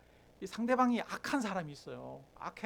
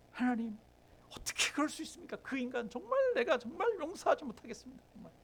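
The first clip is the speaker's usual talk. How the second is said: high, strained complaint to God; acted aggrieved prayer; 5 semitones higher than usual. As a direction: plead in acted indignation, without shouting. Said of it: "그런 욕하는 그 마음을 기도로 가져갈 때". (하나님께 분통을 터뜨리는 기도 흉내)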